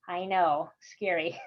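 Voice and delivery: usual speaking voice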